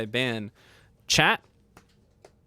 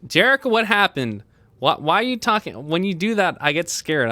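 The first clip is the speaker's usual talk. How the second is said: loud, higher alarmed cry; startled outburst; 6 semitones higher, 8 dB louder than usual. Real, not acted. Streamer startled by a chatter's sudden voice, alarmed. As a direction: caught off guard and spooked.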